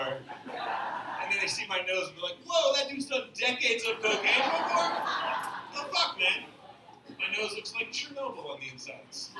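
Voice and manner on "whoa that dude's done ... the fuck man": high, loud mock astonishment; mock shock. Onlookers reacting to his nose, mock shock. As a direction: exclaim it in exaggerated disbelief.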